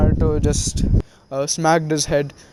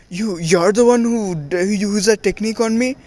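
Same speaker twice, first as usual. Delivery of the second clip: higher, stammered mock outrage; indignant accusation; 5 semitones higher than usual. Jiraiya confronts the boy who used a technique on him, indignant. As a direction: sputter it out, pitch climbing as you accuse.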